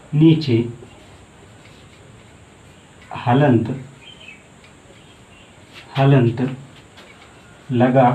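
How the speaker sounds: word by word with long pauses; note dictation. Shown as a demonstration. Teacher dictates important note, slow and halting. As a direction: dictate it slowly so every word gets written.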